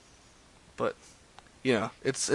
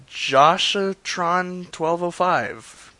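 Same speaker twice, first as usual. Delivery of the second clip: slow put-on reading; 7 semitones higher, 5 dB louder, much slower than usual. Reading a friend request oddly.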